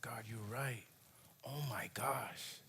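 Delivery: near-whispered, imitating being moved to tears; soft, breath-only surrender to God; tearful realisation. Driver admitting God is right, hushed tearful whisper. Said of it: "And I just started crying".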